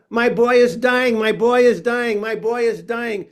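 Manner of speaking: high, loud frantic cry; screaming. Retold or imitated. Drag queen bursting into the ER, screaming for her son. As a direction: wail it to the whole room in a panic.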